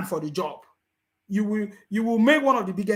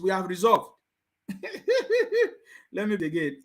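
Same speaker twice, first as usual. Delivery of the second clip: normal talking voice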